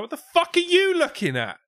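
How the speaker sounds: very high, loud outraged squawk; aggressive Scouse squirrel. Acted. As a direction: yell it as a hammed-up confrontational squirrel. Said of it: "He's like".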